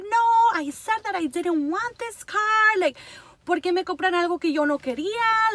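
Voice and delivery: high-pitched retold objection; upset protest. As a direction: protest like an unhappy daughter. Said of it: "I was very upset".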